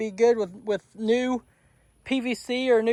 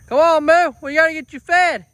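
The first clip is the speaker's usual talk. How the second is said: calling out; 5 semitones higher, 6 dB louder than usual. Real, not acted. Calling horse to feed.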